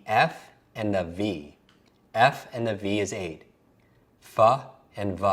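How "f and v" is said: "F and V" as fuh and vuh; F and V sounds. Shown as a demonstration. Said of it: "Notice how they sound alike".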